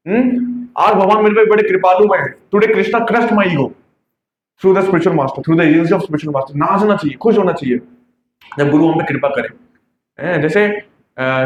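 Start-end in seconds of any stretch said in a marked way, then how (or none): 2.5-6.6 slow, solemn reading of a verse; slow devotional recitation in English; scripture reading. Acted. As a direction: read each word out slowly and reverently. Reads scripture translation slowly.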